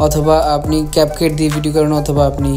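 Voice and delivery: normal talking voice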